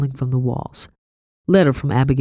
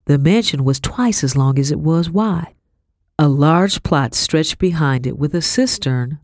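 usual speaking voice

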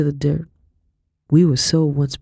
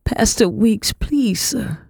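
high-pitched, fragile plea; beg, holding the tears in; fighting back tears; 7 semitones higher, slower than usual. A narrator's reading. Girl begging the pastor for help, fighting back tears. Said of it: "I fought back the tears".

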